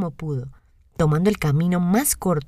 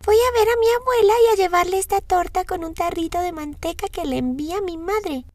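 voz aguda e infantil de niña; 12 semitones higher than usual. A narrator's reading. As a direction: una niña inocente, alta y dulce. Voz de Caperucita. (voz de niña pequeña)

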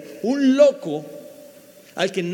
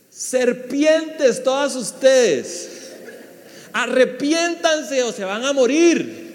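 very slow, higher, louder mock-prophet voice with a laugh; fiery prophet John; 5 semitones higher, 5 dB louder, much slower than usual. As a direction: drag out each word like a wild desert preacher, half laughing. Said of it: "¿Sabe cuál era el mensaje de Juan?".

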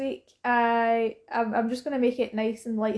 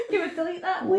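laughing; 7 semitones higher than usual. Real, not acted. Embarrassed after bad accent.

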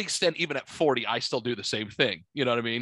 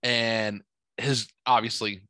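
ordinary talk

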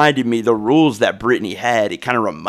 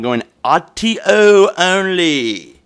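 higher, drawn-out announcer call; mock announcer; 6 semitones higher, much slower than usual. Podcaster telling listeners video is gone, mock announcer call. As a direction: stretch it out like a big announcement.

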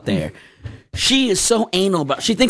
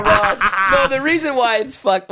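higher, laughing "so wrong"; 5 semitones higher than usual. Real, not acted. Guy laughing at his own kitten story, guilty giggle. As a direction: giggle it out, half scolding yourself.

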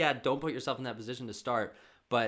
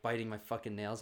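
ordinary talk, nothing marked

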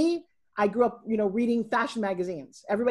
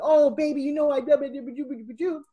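mock motherly fussing, pitched up; gushing mother; 4 semitones higher, 3 dB louder than usual. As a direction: imitate a mom cooing reassurance, trailing into babble. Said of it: "that thing that every other mother does".

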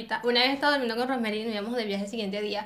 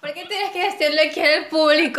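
loud, higher complaint; playful indignant protest; 4 semitones higher, 5 dB louder than usual. Real, not acted. Friend exposed in front of the audience, playfully indignant. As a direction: protest loudly at being exposed.

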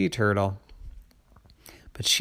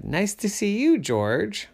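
trickster tale voices; 8 semitones higher than usual. Acted. Tortoise replies.

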